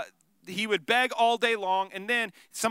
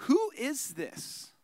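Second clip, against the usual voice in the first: awed question; 2 semitones higher, 4 dB quieter, much slower than usual. Acted. Disciples amazed, slow awe.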